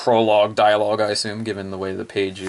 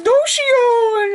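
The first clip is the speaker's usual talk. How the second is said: put-on very high, louder girly wail; Naka's voice; 6 dB louder than usual. Naka crying out for help, very high girly wail. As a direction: cry the name out like a frightened idol. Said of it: "She's like".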